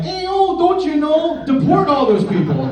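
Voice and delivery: high-pitched, cartoonish Midwestern accent; terrible Midwestern accent. Comic mocking accents from middle America, squeaky bad Midwestern accent. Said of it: "terrible Mickey Mouse impression".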